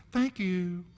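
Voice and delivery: a hint of a sweet, satisfied toddler; sweet toddler thanks. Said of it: "it's the sweetest thing".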